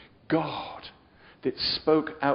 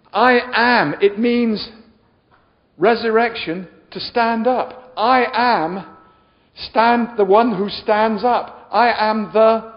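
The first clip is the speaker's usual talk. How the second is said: loud, high, drawn-out declaration; preacher's proclamation; 6 semitones higher, 8 dB louder, slower than usual. Acted. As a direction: hammer out each phrase slowly and loudly.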